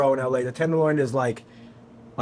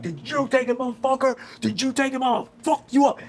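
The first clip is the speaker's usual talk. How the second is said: very high mock scream at talking volume; imitated furious screaming, strained and high; retold screaming; 10 semitones higher than usual. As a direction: act out a frantic accusation, pitched way up.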